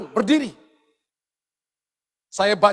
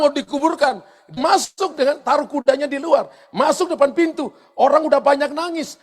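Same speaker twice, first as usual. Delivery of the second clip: usual speaking voice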